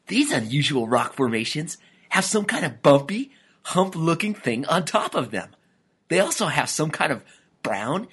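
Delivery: animated cartoon dad, cautiously investigating; Daddy Lyle, lizard father. A narrator's reading.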